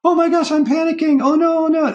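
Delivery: hammed-up oh no, oh no; mock panic. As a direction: act out rising panic. Inner voice panicking over quitting.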